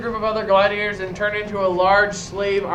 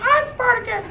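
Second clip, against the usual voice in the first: much higher mock female voice; woman's voice. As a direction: squeak it like a woman in the crowd. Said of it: "some chick stands up and says".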